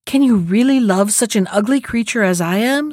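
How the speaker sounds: plain reading voice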